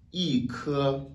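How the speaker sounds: "i ke" with a high falling "i"; fourth-tone yi. Shown as a demonstration. Teacher gives first-tone example, "i" falling in "i ke". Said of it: "le i devient automatiquement quatrième ton".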